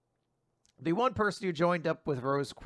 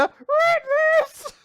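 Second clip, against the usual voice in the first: much higher squeaky little sister; 7 dB louder than usual. Retold or imitated. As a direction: squeak it like a cartoon kid. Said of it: "squeaky voice".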